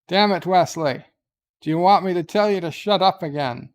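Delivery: hammed-up commander out of patience; exasperated scolding. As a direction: threaten the ensign with a telling-off. Captain loses patience with ensign.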